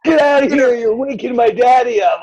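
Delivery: put-on high-pitched little-kid holler; imitated boy's indignant yell; child yelling. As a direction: scold strangers like a small boy turning to shout.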